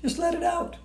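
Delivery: ordinary delivery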